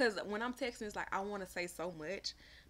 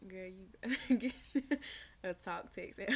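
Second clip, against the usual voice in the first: laughing. Real, not acted. Reading a chat comment, laughing.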